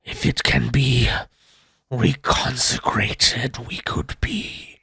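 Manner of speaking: slow, hollow whisper from beyond the grave; ghostly whisper. Acted. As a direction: breathe it out like a trapped spirit. GM voices the spirit.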